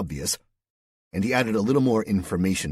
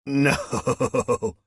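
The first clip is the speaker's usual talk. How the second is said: high, loud nervous burst; shy man laughing too hard; 7 semitones higher, 6 dB louder than usual. A narrator's reading. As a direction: blurt it through nervous laughter. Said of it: "nervous intensity".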